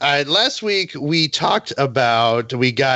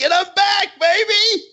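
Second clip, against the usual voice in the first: triumphant shout. Real, not acted. To friends, returning to blood tanking, a high triumphant shout. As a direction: announce your comeback like a victory cry.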